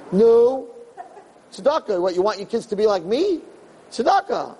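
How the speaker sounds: put-on pleading kid.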